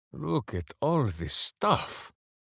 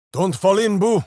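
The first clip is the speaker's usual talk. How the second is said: loud, higher call to a falling hamster; alarmed call; 5 semitones higher, 8 dB louder than usual. Acted. Minsc calling out to Boo as he falls, alarmed. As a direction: yell out in alarm.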